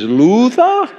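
put-on high, questioning English-accented nobleman. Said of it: "He didn't say it with an English accent".

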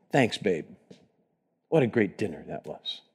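low, soft appreciative husband; tender compliment. Acted. Husband thanking his wife for dinner, low and tender.

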